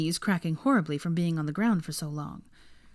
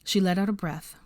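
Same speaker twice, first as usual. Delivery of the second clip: ordinary delivery, nothing marked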